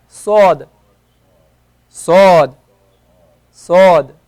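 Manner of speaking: emphatic Arabic sad, said loudly. Shown as a demonstration. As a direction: push out a heavy, hollow s. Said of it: "Let's try it".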